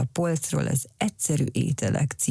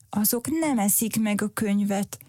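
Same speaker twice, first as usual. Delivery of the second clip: talking back; 6 semitones higher than usual. A narrator's reading. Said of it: "feleselt Cirmacs".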